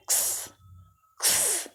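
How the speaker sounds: क्स as a soft breathed k-s with no vowel; ending sound demo. Shown as a demonstration. Teacher isolates the ending sound for pupils, soft k-s. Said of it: "तो क्या आ रहा है?".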